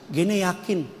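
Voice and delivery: low, soft retold reply from a child; quiet trusting child. Retells child's sure, trusting answer. (suara anak yang pelan dan yakin, ditirukan)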